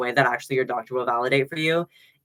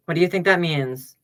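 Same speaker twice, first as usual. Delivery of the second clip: ordinary talk, nothing marked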